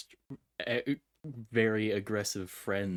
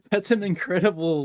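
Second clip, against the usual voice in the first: higher, louder laugh into words; laughing delight; 9 semitones higher, 9 dB louder than usual. Real, not acted. Laughing at remark.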